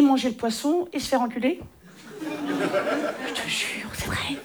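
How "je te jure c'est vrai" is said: half-laughing whisper; giggly whispered aside. Real, not acted. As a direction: lean in and whisper it. Confiding aside.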